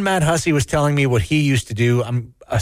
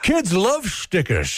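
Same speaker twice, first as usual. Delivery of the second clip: put-on slow, much higher old-timer voice; mock grandpa; 6 semitones higher, slower than usual. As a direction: play a doddering grandpa approving of stickers. Mocking grandpa gift-giving.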